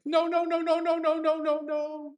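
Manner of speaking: high wailing mock sobs, pitch way up; mock crying. Mocking a sobbing player on camera. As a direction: whimper it like a crying child. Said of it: "as tears are streaming down his face".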